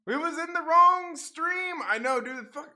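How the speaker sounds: high, loud, slow exasperation; real frustration squeezed high; exasperated whine. Real, not acted.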